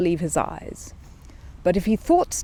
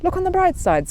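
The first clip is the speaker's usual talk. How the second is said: much higher, louder cheerful lady voice; cheery lady; 13 semitones higher, 6 dB louder than usual. A narrator's reading. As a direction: brightly cheer him up.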